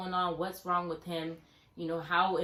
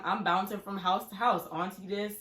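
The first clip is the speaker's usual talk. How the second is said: usual speaking voice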